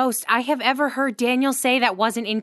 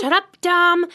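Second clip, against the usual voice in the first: loud, higher snap; sharp snap; 6 semitones higher, 6 dB louder than usual. Real, not acted. Friend telling Dom off for teasing, loud snap. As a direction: cut him off loudly.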